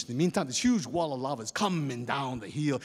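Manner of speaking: ordinary delivery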